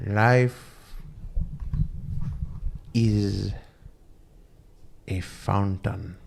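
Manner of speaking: solemn guru pronouncement. Retold or imitated. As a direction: intone it like a wise guru, one word at a time, on "Life is a fountain". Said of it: "the guru looks at him and he goes".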